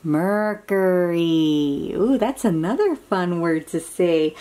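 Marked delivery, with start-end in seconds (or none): 0.0-1.9 Mercury stretched out slowly, syllable by syllable; slow, clear modelling. Shown as a demonstration. Modelling the word for a child to repeat, slow and clear. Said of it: "Can you say that?".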